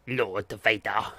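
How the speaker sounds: lightly voiced slow Emperor, barely changed; a hint of a sinister old emperor; Emperor impression. Streamer attempting an Emperor voice, slow and faint. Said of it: "I can't do the Emperor accent very well".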